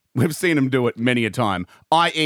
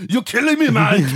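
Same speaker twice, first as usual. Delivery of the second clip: mock Arnie laughing; 8 semitones higher than usual. Podcaster as Arnie laughing at Mike, hammed-up laughing shout. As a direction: shout it through put-on laughter. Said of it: "imagine Arnie doing that now".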